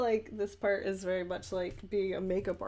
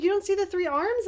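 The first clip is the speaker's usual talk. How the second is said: high, insistent mock question; retold visitor, high and pushy; incredulous woman; 10 semitones higher, 5 dB louder than usual. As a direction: press her disbelief, pitched up. Visitor insisting on three arms.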